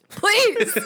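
high, loud laughing please. Real, not acted. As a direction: beg through laughter. Laughing begging.

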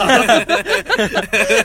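loud high laugh bursting out; burst of laughter. Real, not acted. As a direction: crack up at your own story. Laughing at his own story.

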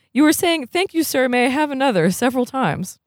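high-pitched, earnest character voice; young brother in dialogue. Acted. Voices Mario in the story.

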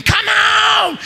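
high-pitched imitated holler; mock scream. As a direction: yell it high and long over the crowd. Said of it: "somebody else screaming".

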